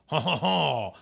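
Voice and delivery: mock French laugh and accent. Shown as a demonstration. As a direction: put on a hammy French accent. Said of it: "do you have a French accent?".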